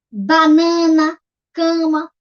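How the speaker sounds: BANANA very slowly, BA, NA, NA; syllable by syllable. Shown as a demonstration. Teacher gives three-syllable example, syllable by syllable. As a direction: give BANANA three drawn-out, emphatic beats. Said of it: "palavras que têm três sílabas".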